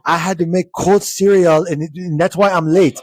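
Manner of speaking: playful Nigerian accent impression; imitating Nigerian accent. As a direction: put on the classmate's heavy Nigerian accent. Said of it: "and he said it like that".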